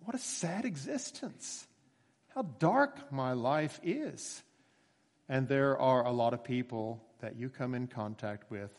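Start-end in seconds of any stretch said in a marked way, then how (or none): 0.0-4.4 a soft, sighing lament; mock despair. Preacher voicing a sad soul, soft lament. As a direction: sigh out how dark it all feels. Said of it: "then they think".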